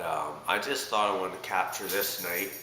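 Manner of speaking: normal talking voice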